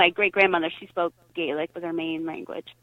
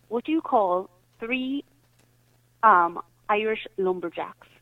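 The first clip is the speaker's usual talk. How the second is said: put-on slow, light Irish accent for the joke setup; an Irish brogue, drawn out to tee up the punchline; slower than usual. Telling friends a pub joke in an Irish accent. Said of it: "I'll tell you a joke in an Irish accent".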